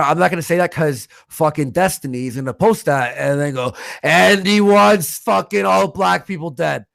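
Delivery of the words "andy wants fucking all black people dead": louder, higher, drawn-out mocking quote; mock accusation. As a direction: parody a smear, slow and loud. Imagines rival's smearing post.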